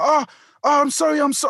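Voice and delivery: high-pitched mock apology; apologetic woman. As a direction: imitate a flustered woman saying sorry. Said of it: "the woman I'm talking to was quite apologetic".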